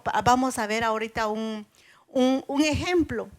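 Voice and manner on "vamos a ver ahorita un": usual speaking voice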